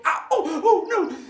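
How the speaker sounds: very high, loud ows; yelping in pain. Acted. The kicked thief yelps high in pain. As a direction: yelp each ow like a kicked man.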